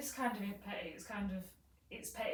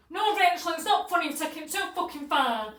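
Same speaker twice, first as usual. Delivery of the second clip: high, loud mimicked telling-off; acted angry scolding; 7 semitones higher, 13 dB louder than usual. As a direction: scold the prankster in a raised voice. Retold scolding after the prank.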